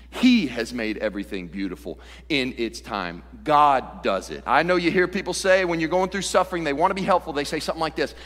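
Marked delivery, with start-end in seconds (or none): 0.1-3.2 "He" hit first, the rest lower; strong opening weight on "He"; stress on "He". Shown as a demonstration. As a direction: punch "He", then fall away. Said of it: "Or the word he".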